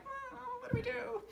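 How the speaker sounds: high, soft puzzled hum; mock puzzled self. Author acting out being stuck, high and puzzled. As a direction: pitch it up like a stumped writer. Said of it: "'cause I'm like".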